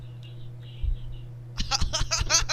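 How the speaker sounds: airy helpless laughter; breathless laugh. Real, not acted. Friend cracks up at a tweet, breathless laugh. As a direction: wheeze with laughter.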